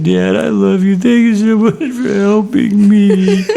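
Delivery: high, loud, slow gushing thanks; tearful grateful daughter. Retold or imitated. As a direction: gush through a laugh like a drugged daughter. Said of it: "she's like".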